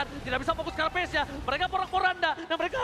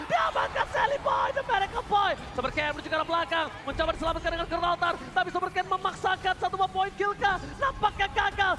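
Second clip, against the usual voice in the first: shouting play-by-play; 5 semitones higher than usual. Real, not acted. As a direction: scream the big play to the crowd. Caster screaming a decisive kill.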